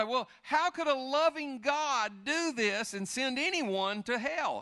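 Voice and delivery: high, slow whiny objection; mocking skeptic voice. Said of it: "And people say".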